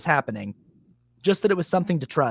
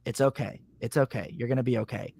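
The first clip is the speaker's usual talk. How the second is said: softer, lower gentle reassurance; calm reassuring voice; 5 semitones lower, 8 dB quieter than usual. Retold or imitated. Clear inner voice calms a panicking man, softer and lower. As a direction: soothe him like a calm inner guide. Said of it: "this really crystal clear voice that was like".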